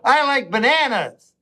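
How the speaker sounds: lightly voiced happy friend; a hint of a childlike cheerful friend; simple happy friend voice. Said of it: "a guy with Down syndrome, to go".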